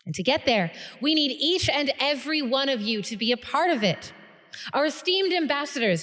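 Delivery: high, loud formal oratory; rally the whole room to join you; rousing guild leader. Acted. Guild leader addresses banquet, rousing speech.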